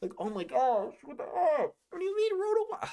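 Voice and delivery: climbing very high in disbelief; rising squeak of outrage; outraged disbelief. Real, not acted. A fan reacting to a weak card, outraged disbelief.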